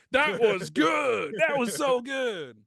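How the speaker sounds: high, loud, slow fake cheering; mock ecstatic shout. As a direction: shout the praise, stretched out. Mimicking friend's ecstatic praise.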